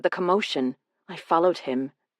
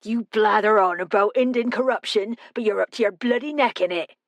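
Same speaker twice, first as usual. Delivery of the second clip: put-on raised-pitch West Country accent, accusing; West Country growl; 4 semitones higher than usual. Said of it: "his accent pure West Country".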